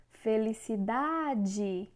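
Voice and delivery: feliciDAAde, DA drawn out long; stress on DA. Shown as a demonstration. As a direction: slowly stretch the DA in felicidade. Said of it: "O da é a sílaba mais forte".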